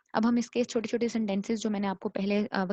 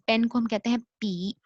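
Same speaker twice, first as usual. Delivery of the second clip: ordinary talk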